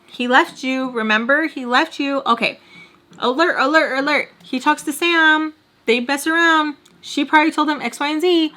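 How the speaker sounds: high, insistent inner alarm; alarm-like reminder. Acted. Her brain warning her about the ex, high urgent alert. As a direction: blare it out like a warning system. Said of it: "the reminder came straight to the front".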